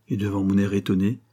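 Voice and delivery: plain reading voice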